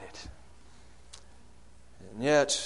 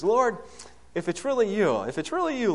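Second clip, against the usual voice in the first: higher-pitched eager plea; Peter calling out; 8 semitones higher, 4 dB louder than usual. Acted. Peter calls to Jesus across the water, acted eager appeal. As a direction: call up high to the Lord.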